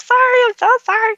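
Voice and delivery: high, loud, weepy mock sobbing apology; tearful apology. Retelling her teary apology while blowing her nose on air, high and loud. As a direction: squeak it out like she's crying into the mic. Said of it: "just like".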